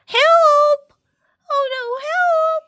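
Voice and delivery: high, loud mock panic; cry for help. Reader voicing panic over a runaway pram. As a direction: call for help in alarm.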